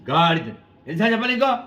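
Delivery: an adult berating someone; scolding insult. Retold or imitated. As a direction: snap at someone calling them a donkey. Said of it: "అన్నారు".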